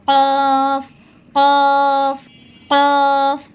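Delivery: কফ loud and deep from the back of the tongue; কফ heavy, from the back of the mouth; deep Arabic qaf. Shown as a demonstration. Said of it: "জিহ্বার গোড়া, তার উপরে তালুর সঙ্গে".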